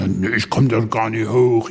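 lightly voiced chubby little objector; little fat fellow. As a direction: imitate a little fat man protesting. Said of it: "da sagte so ein kleiner Dicker".

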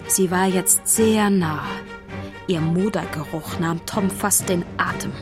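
ordinary delivery, nothing marked

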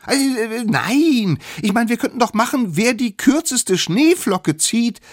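high, loud squeaky voice; stammer, then rush out the idea; fast, desperate character squeal; flustered little one. Acted. Smallest improvises a way out.

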